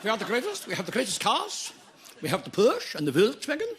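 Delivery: put-on German accent. Boastful German character speech.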